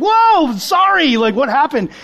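high, loud distressed rush of sympathy; upset Jess. Retold or imitated. Mimicking Jess reacting to the hurt dog, high and loud. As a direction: play her welling up over the dog, high and loud.

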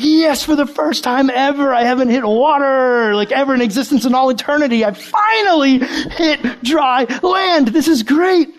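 imitating a friend's giddy golf cheer; mock excited. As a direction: ham up a giddy triumph. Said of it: "And he's like".

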